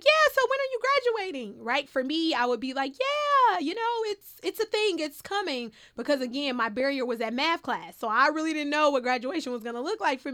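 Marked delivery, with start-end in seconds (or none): 0.0-1.6 high, quick, louder prying question; nosy relative. Retold or imitated. Relative asking at holiday dinner, high nosy questioning. 3.0-5.7 high-pitched, awkward dodge; evasive reply. Retold or imitated. Student dodging relatives' graduation question, high evasive reply.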